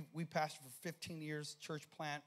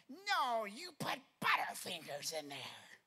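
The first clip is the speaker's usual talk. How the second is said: high, accusing mother voice; high mock accusation, slowing down; 8 semitones higher, 4 dB louder, slower than usual. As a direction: blame the pastor in her voice.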